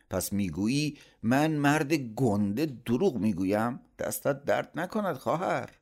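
high, indignant voice; offended retort. A narrator's reading. Uncle feels disbelieved by his sister, offended.

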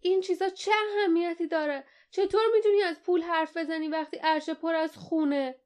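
high-pitched protest without much volume; outraged cry. A narrator's reading. Boy confronts the captain over the blood on deck, crying out high. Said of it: "لیف فریاد زد".

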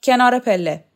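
forceful commanding voice; barked order. A narrator's reading. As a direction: order the prisoner aside. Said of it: "راخوف دستور داد".

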